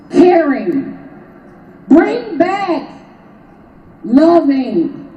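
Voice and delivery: impassioned rally preaching, pitched high. Acted. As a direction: preach it to the crowd. Rally speech, high impassioned delivery.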